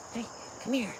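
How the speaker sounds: high, soft sing-song call to a dog; high soft pet-calling voice; coaxing dog call. Real, not acted. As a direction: coax the dog over sweetly in a high voice.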